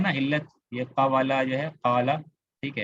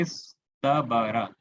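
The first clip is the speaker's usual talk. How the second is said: इस तबारा drawn out slowly syllable by syllable; teacher slowly sounding out इस तबारा; slow spelling-out; 2 semitones lower, much slower than usual. Shown as a demonstration. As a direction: stretch each sound of इस तबारा for students. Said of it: "ये word, word क्या देख रहे हैं".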